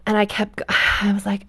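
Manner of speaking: ordinary talk, nothing marked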